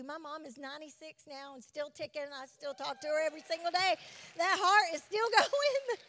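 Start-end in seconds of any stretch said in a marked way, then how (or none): 4.4-6.1 higher, louder, laughing through it; delighted chuckle over her mom. Real, not acted. Laughs about her mother.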